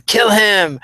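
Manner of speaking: short, punchy imitation of a shouted order; Mario Yamasaki yelling. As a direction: yell it like a ref egging on a fighter. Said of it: "Mario pointing and yelling".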